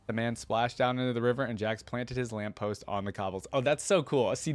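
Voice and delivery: usual speaking voice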